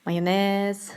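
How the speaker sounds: mayonnaise as MY-o-NEZ; mayonnaise with MY first and a short E at the end; wrong pronunciation. Shown as a demonstration. Teacher quoting how many people say mayonnaise, mispronounced my-o-nez. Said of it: "many people say mayonnaise".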